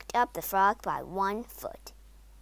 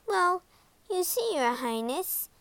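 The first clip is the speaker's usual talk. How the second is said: higher, hesitant maid; nervous maid; 8 semitones higher than usual. A narrator's reading. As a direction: break the news haltingly to royalty. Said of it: "the maid began nervously".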